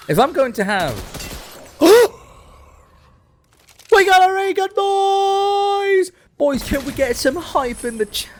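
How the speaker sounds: hype yell. Real, not acted. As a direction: scream with joy at the Ray Gun. Hyped about getting Ray Gun.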